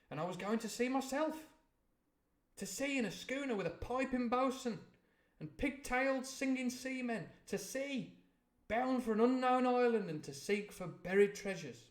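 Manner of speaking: put-on a boy's thrill building to a peak; breathless joy at going to sea; excited. A narrator's reading. Boy dreams of going to sea.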